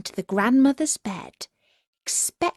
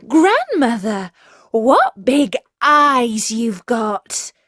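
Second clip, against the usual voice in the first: put-on louder, slow, wide-eyed child; amazed girl; 3 semitones higher, 7 dB louder, slower than usual. Girl staring at the wolf in bed, amazed. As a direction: stretch it out in growing wonder.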